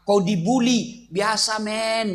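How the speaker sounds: ordinary talk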